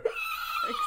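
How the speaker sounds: shrill pterodactyl screech; pterodactyl shriek. Pretend. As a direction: shriek high like a giant flying reptile. Pterodactyl swooping in.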